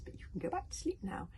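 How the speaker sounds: gentle, breathy lullaby tone; hypnotic old dragon. A narrator's reading. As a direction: lull into forgetting. Wodensfang lulls Toothless to sleep.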